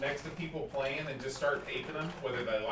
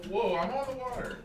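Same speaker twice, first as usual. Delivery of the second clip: surprised; 10 semitones higher than usual. Real, not acted. Surprised while playing game.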